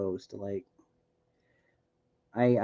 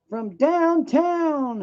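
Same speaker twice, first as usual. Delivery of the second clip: high, slow, drawn-out announcer call; sports announcer; much slower than usual. Pretend. Basketball announcer catchphrase.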